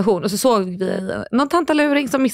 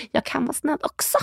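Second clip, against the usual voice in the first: higher, softer, half-laughing protest; mock bitter; 6 semitones higher, 6 dB quieter than usual. Podcast host insisting on own kindness, mock bitter. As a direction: play bitter, quietly and high. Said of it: "låter jag ju väldigt bitter".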